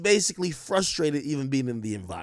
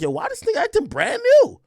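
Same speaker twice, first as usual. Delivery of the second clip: much higher, incredulous mock voice; mock Meg; 5 dB louder than usual. Imitating Meg staring at Tory, high and incredulous. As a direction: squeak it out like she can't believe him. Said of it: "Meg is looking at him like".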